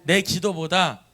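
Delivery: slow, very loud lead; repeat-after-me call. Shown as a demonstration. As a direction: spell it out slowly at full volume. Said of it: "다시". (느리고 아주 크게 선창)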